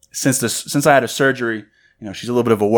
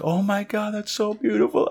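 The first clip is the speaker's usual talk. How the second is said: high, softer mock-tearful voice; imitated tearful gushing; 11 semitones higher, 7 dB quieter than usual. Retelling fiancée's mom's reaction to the ring, mock-tearful. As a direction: imitate her overcome with joy, pitched way up. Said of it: "she just starts crying".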